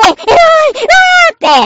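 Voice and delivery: loud, high, stretched-out wow; excited cry. Retold or imitated. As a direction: gasp with delight, drawn out. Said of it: "って見てるうちに".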